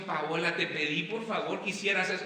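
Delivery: ordinary talk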